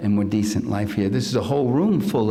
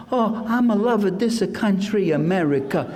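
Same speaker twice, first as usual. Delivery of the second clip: put-on higher, hammy movie-Italian accent; old-movie fake Italian accent, gushing; 8 semitones higher than usual. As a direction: ham up a bad film Italian accent. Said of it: "no Italian had an accent like that".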